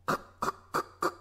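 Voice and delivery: fast whispered heel clicks; high heel clicks. Retold or imitated. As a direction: tap it out like running heels. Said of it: "ハイヒールの音を鳴らしながら".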